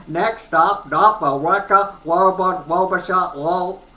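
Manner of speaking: calling out the stops on the train; train conductor impression. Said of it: "I used to imitate the train conductor".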